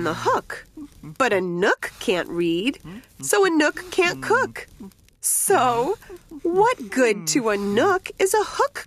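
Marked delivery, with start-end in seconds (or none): none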